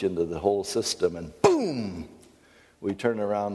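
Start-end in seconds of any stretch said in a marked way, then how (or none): 1.4-2.1 high, drawn-out falling "boom"; imitated boom. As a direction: burst out with a sudden explosion noise. Imitated crash when a glitch hits.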